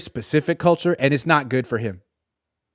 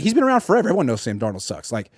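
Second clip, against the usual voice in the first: ordinary delivery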